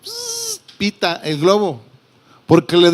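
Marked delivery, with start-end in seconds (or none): 0.0-0.6 high thin hiss of air escaping a balloon; squeaky imitated balloon whistle. Pastor imitating a deflating balloon for the congregation, high airy hiss. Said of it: "Hasta se oye pitar".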